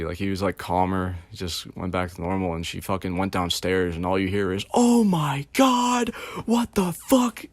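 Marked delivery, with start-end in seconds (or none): 4.7-7.4 very high, slow, drawn-out horrified cry; retelling the woman's horror at the mess, very high and drawn out; shocked shriek. Retold or imitated. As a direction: shriek like you just saw the wreck.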